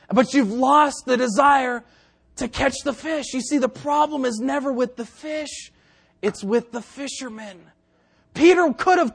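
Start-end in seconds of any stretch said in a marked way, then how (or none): none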